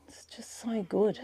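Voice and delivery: hushed, breathy whisper. Real, not acted. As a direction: whisper it in awe. Quiet awe at the view.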